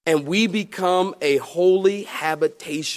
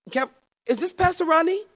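higher, curious woman on the phone; lady caller voice; 12 semitones higher than usual. Retold or imitated. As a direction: play the eager lady caller.

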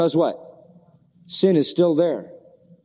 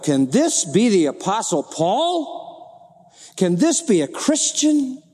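mildly acted disbelief, pitch swooping high; incredulous questioning; 4 semitones higher, slower than usual. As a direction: ask it slowly as if you can hardly believe it. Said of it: "And you say to yourself".

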